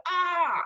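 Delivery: short, high-pitched mock yell; demonstrated mild scream. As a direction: let out a contained "ah" of frustration. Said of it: "not crazy screaming, but just like".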